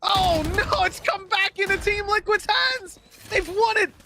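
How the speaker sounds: high-pitched esports commentary building to a peak; excited play-by-play. Real, not acted. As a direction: call the action with rising urgency, voice climbing very high by the end. Live play-by-play as the round turns.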